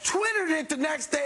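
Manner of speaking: ordinary talk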